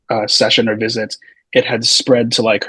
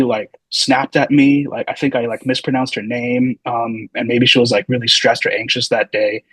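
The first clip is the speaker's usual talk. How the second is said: ordinary delivery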